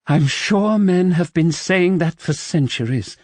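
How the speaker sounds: gasping. A narrator's reading. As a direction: gasp it out breathlessly. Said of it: "she managed to gasp".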